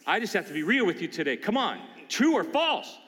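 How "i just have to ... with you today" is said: normal talking voice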